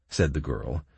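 usual speaking voice

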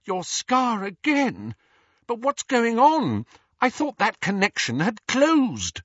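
put-on high, worried girl's voice; frightened. A narrator's reading. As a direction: ask it scared and pleading. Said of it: "said in a frightened voice".

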